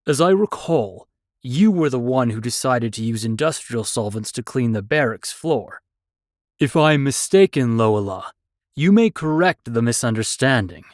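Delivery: stern drill instructor, calmly reproving; stern old mentor. Acted. Dara scolds the girl.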